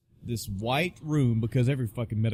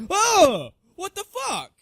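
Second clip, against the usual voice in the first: much higher and louder shocked yelp; shocked outburst; 8 dB louder, slower than usual. Retold or imitated. Patient shrieking at the doctor holding his tooth. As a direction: blurt it out in total disbelief.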